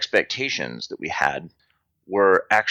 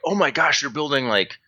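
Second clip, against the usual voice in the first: high-pitched retold astonishment; excited, pitched-up wow reaction; amazed excitement; 6 semitones higher than usual. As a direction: gush in wonder at a discovery. Retold amazement at seeing new project.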